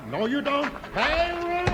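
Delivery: shouted high alarm call; calling for help. Acted. Ticket man yells to rally help. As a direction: yell for the carnival crew.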